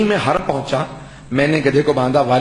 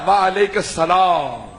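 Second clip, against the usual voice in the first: answer from the shrine; 4 semitones higher, much slower than usual. Acted. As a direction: let the holy voice answer, drawn out and hushed. (مزار سے آتی ہوئی دھیمی، ٹھہری ہوئی آواز)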